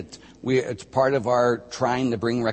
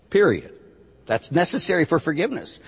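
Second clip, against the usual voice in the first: usual speaking voice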